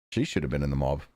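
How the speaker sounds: low, quiet, bitter venting; real seething anger. Real, not acted. Player venting about the scheming madam, low and seething. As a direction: grumble it out through clenched fury. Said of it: "I'm so fucking pissed off".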